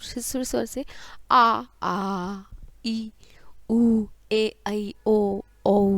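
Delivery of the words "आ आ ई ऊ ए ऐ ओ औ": drawn-out आ, ई, उ, ए, ऐ, ओ, औ, each held double length; long vowels. Shown as a demonstration. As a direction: hold आ, ई, उ, ए, ऐ, ओ, औ evenly on a level pitch. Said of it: "वो दुगना समय लगता है ह्रस्व". (आ, ई, उ, ए, ऐ, ओ, औ का लंबा, दीर्घ उच्चारण)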